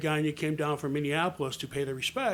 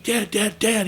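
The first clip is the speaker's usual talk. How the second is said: insistent, raised-pitch calls for Dad; pestering child; 7 semitones higher, 7 dB louder, slower than usual. Retold or imitated. Kid trying to get his dad's attention, pestering. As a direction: keep tugging for Dad's attention.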